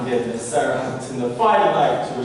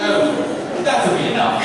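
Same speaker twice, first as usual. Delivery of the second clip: laughing; 4 dB louder, slower than usual. Real, not acted. Laughing at butler's act.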